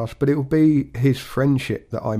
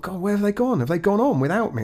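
higher, mock-panicked inner thought; retold panic; 8 semitones higher than usual. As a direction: replay your sudden alarm, pitched up. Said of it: "I'm sort of in a bit of a panic".

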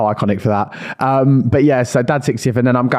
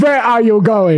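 higher, slow, hammed-up French guard; bad French accent; 10 semitones higher, 3 dB louder, slower than usual. Security guard stopping the wine thief, in a hammed-up French accent. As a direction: shout it as a mock French bouncer. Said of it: "I can't do a French accent".

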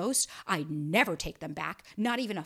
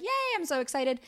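mock excited; 7 semitones higher than usual. Mock excitement over his party.

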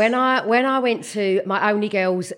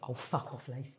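low, very soft dismissal; imitated hushed swearing at a friend; muttered brush-off; 10 semitones lower, 20 dB quieter than usual. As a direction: mutter it under your breath. Friend brushes off sick passenger.

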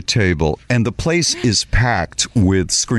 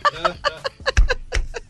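breathy laugh; 5 dB quieter than usual. Real, not acted. Unvoiced high laugh.

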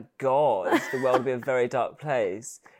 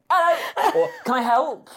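high, loud pop-up hello; spring a shrill-pitched greeting on someone; mock shop greeting; 11 semitones higher, 6 dB louder than usual. Hidden shop assistant surprising a customer, high mock greeting. Pops out of clothes rail at customer.

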